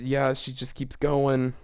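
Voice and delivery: plain reading voice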